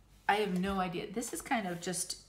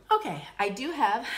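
higher, louder restart after tears; pulling herself together; 6 semitones higher, 8 dB louder than usual. Real, not acted. Vlogger moving on after a tearful thank-you, forced composure. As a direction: push on brightly to stop yourself crying.

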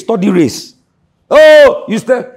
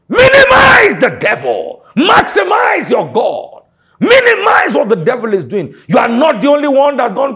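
preaching shout; 6 semitones higher, 5 dB louder than usual. Real, not acted. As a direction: drag each word out in a big shout. Preacher exhorting congregation, shouting.

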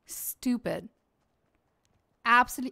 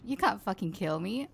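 ordinary talk, nothing marked

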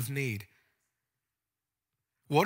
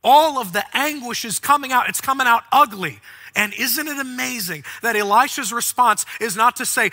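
loud, high preaching climax; full-force preaching; 7 semitones higher, 9 dB louder than usual. Acted. Preacher stirring the congregation, preaching at full force. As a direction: drive the point home to the church.

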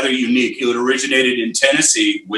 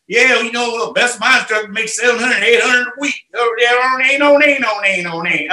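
Missouri drawl; 9 semitones higher than usual. Retold or imitated. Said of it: "a guy talk like this. He, he's from Missouri".

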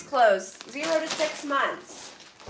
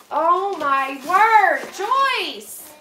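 amazed exclamation; 2 semitones higher, 5 dB louder, much slower than usual. Real, not acted. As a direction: stretch it out in astonishment. Opens a very full box.

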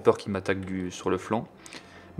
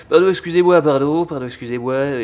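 loud, raised pardons easing off; lightly voiced driver pushing through; fussy taxi driver; 5 semitones higher, 8 dB louder than usual. Taxi service boat squeezing past, comic apologizing.

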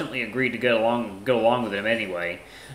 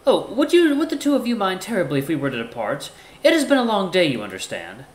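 higher-pitched, measured formal character; courteous Ty; 8 semitones higher than usual. Acted. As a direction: speak up high, politely composed. Voices Ty in story.

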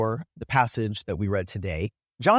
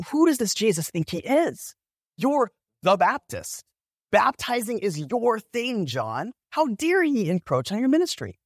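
high-pitched, affronted mock outrage; indignant; 8 semitones higher than usual. Disciples riling up John about the upstart Jesus, indignant. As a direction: act out offended loyalty, pitch jumping up. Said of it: "You can just see this indignation".

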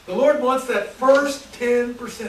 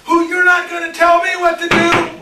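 higher, louder defiant yell as Cain; defiant shout; 9 semitones higher, 9 dB louder than usual. Acted. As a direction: throw it back in God's face. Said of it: "He says".

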